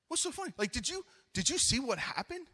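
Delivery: high, soft winded questioning; acted out of breath. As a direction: ask it as if winded, pitch up. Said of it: "out of breath".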